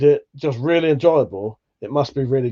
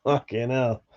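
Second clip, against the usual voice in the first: ordinary talk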